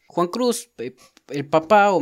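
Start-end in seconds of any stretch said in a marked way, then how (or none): 0.1-0.7 high mock call. Shown as a demonstration. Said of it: "nunca Juan Cruz".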